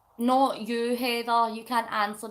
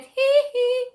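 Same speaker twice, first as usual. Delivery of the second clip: very high falsetto hee hee; 13 semitones higher, 7 dB louder than usual. Retold or imitated. Teasing a friend by echoing his high hee hee. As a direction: squeal it up high like the pop star. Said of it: "'Cause you said".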